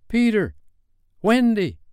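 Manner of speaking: high-pitched, loud calling out of names; shouting. A narrator's reading. Boys shouting for lost Peter and Wendy, calling out. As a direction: call out across the water for lost friends.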